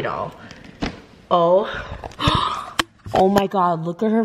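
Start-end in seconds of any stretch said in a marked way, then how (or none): 1.7-2.8 gasp. Real, not acted. Noticing details on doll.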